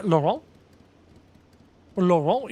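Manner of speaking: "Laurent" ending on a nasal vowel, no T; silent final T. Shown as a demonstration. Streamer explaining French names to chat. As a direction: say "Laurent" as "lo-RAHN". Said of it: "you don't pronounce the T".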